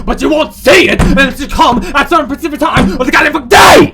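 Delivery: loud, high, building shout; theatrical fed-up yelling; exasperated rant. Acted. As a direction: rant at the game's absurd puzzle. Ranting about a hidden key.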